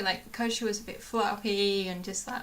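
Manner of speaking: ordinary delivery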